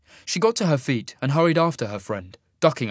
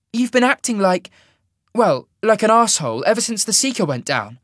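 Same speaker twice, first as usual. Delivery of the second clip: scolding; 11 semitones higher, 8 dB louder than usual. A narrator's reading. Sasha scolding Cole.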